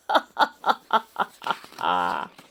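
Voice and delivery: big low belly laugh; roaring laugh. Acted. Lion roaring with laughter instead.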